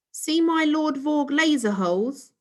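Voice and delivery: high, loud eager show-off; eager boy. Acted. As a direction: show off your rocket keenly. Boy showing off.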